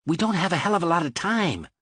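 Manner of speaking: fast, urgent delivery; quickly. A narrator's reading. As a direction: rush it, time is short. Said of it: "Mal said quickly".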